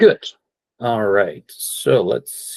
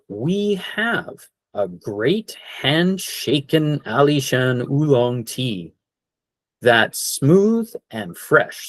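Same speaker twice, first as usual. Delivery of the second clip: slow model phrase; 4 semitones higher, slower than usual. Shown as a demonstration. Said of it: "You could say something like".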